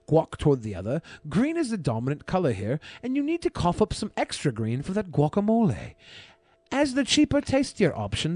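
measured, deliberate review narration; a hint of a British accent in the read; food review voiceover. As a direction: read it like a taste-test video host. Reads his food review voiceover.

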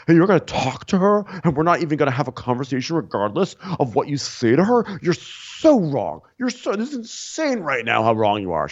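high, indignant mock rant; worked-up, incredulous tirade; raging. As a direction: imitate a man ranting in outrage. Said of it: "he actually rages all the time".

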